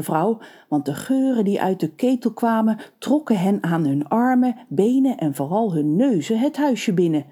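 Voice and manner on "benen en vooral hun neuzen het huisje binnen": usual speaking voice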